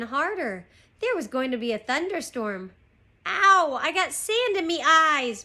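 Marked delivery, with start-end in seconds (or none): 3.3-5.4 loud, high pirate whine; high-pitched wailing complaint; whining. A narrator's reading. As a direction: whine in pain to the captain. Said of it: "Pinky whined".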